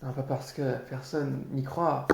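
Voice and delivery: normal talking voice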